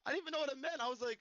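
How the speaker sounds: ordinary talk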